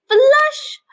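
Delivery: high, loud flush; playful shout. Acted. Teacher shows flushing, playful high shout. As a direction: call out flush with a burst.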